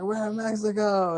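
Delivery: high-pitched imitation of a jubilant yell; retold triumphant cry. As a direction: announce it in high, acted elation. Acts out Clooney's triumphant cry.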